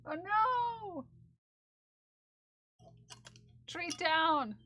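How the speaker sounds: high, loud mock alarm. Real, not acted. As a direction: call it like an emergency. Treat dropped.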